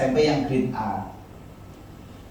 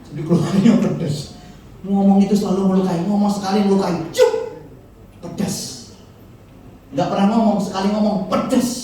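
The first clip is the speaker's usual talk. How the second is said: mock sharp talker; 7 semitones higher, 3 dB louder than usual. Mocking harsh-tongued people.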